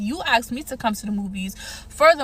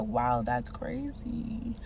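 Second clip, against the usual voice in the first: slow, unimpressed whisper; mock-polite whisper; 9 dB quieter, much slower than usual. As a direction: whisper it slowly, faking interest. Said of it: "I'm like".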